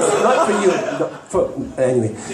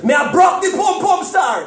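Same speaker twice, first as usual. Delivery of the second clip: put-on Jamaican accent; 8 semitones higher than usual. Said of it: "He said it differently. He's Jamaican".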